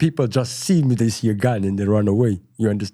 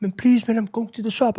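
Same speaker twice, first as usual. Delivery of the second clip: much higher, pleading mimic of the girlfriend; imitating girlfriend; 12 semitones higher than usual. Narrator voicing girlfriend leaving for shop, high and pleading. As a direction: beg him to let her go, pitched high.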